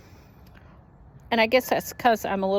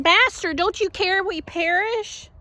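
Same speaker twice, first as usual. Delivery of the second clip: panicked plea; 11 semitones higher than usual. Acted. Disciples in the storm beg their sleeping master, panicked cry. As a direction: cry out like men sure they'll drown.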